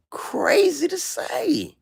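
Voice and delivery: breathy high whisper, slowed right down; stretched, hushed emphasis. Real, not acted. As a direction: drop to a whisper on crazy. Emphatic aside about her comments.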